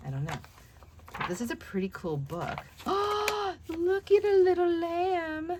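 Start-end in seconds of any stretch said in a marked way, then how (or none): 2.6-3.6 high breathy gasp of delight. Real, not acted. Delighted at lamb picture. 3.7-5.5 high cooing voice over something cute; cooing delight. Real, not acted. Delighted at lamb picture.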